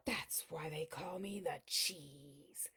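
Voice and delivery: breathy hushed whisper. Acted. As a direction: lean in and whisper it. Whispered after gasps.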